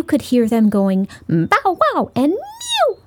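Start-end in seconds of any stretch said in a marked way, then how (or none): 1.3-2.1 playful imitated bow wow; dog bark. Dogs barking in story. 2.3-3.0 very high squeaky mew; tiny kitten mew. Acted. Kitten mewing in story.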